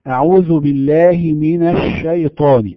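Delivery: الشيطان with a long, spread sh hiss; spread sh sound. Shown as a demonstration. Teacher demonstrating tafashshi in recitation. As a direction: let the sh of الشيطان spread through the mouth. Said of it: "هذا الصوت الذي نسمعه للشين".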